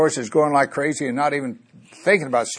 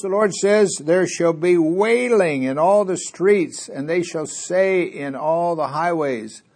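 higher, drawn-out Bible reading; scripture reading; 5 semitones higher than usual. Acted. Preacher reads a prophecy of wailing to the congregation, proclaiming. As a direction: proclaim the prophecy, stretching the key words.

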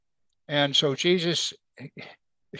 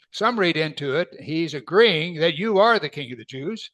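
usual speaking voice

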